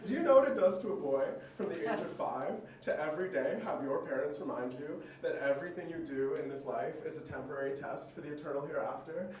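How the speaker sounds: ordinary delivery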